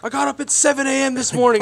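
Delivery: quick, high-pitched mock rant; irate shopper. Shopper complaining to a worker, mock irate and rushed. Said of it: "he's yelling and cussing".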